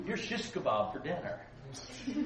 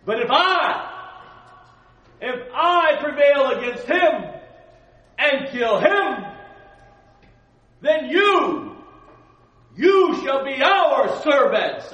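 slow, huge roaring giant voice at the top of the lungs; booming Goliath; 12 semitones higher, 17 dB louder, slower than usual. Acted. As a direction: bellow the challenge like a giant warrior. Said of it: "it's better if I do it this way".

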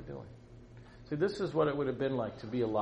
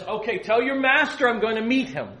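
raised, louder prophet's reply; bold proclamation; 9 semitones higher, 9 dB louder than usual. Acted. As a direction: announce it high and loud as Elijah. Elijah's defiant message, acted out.

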